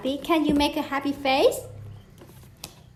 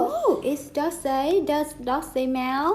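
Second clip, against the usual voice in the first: usual speaking voice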